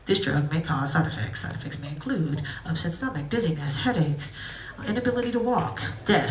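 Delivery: low, quick side-effects disclaimer; drug disclaimer voice. Retold or imitated. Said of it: "real quiet, real fast".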